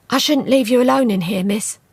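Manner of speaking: a hint of a fretting servant; worried maid. A narrator's reading. Dodds fretting to Juliet, lightly voiced.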